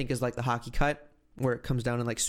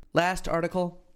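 higher, slow storyteller news delivery; dramatic news read; 6 semitones higher, slower than usual. Acted. Podcast host revealing car full of bees, dramatic news read. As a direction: read the headline story with drawn-out suspense on key words.

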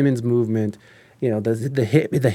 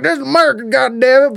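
high, loud flag-waver outburst; mock a worked-up patriot; caricatured loud patriot; 10 semitones higher, 5 dB louder, faster than usual. Not wanting to sound like one of those, caricatured patriot. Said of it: "sound like one of those".

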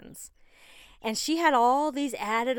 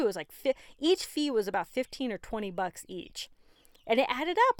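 ordinary talk, nothing marked

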